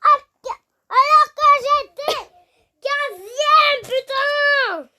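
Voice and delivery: loud, high gamer frustration; loud annoyed cry; frustrated outburst. Real, not acted. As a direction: shout it as your rank slips away. Losing rank in game, upset.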